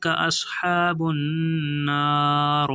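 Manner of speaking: slow, measured tajweed recitation; slow Quran recitation. Shown as a demonstration. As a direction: draw the words out slowly, chanting. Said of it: "teacher recites the full phrase".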